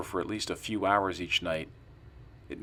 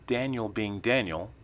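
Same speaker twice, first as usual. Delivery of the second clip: ordinary delivery, nothing marked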